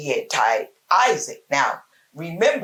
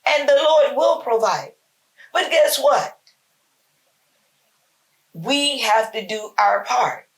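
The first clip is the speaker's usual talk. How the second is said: preaching climax; 6 semitones higher than usual. Acted. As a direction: lift it like a sermon peak.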